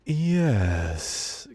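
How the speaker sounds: long drawn-out, ethereal ghostly word. Acted. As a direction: stretch it out and let it sink like a ghost. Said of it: "Bring me ghost voice".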